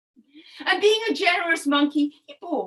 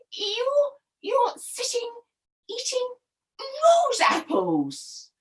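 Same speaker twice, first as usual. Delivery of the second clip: indignant wife climbing higher in pitch; jealous storybook wife, pausing between phrases; accusing jealous wife; 6 semitones higher than usual. Acted. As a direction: build the accusation, stretching the last words.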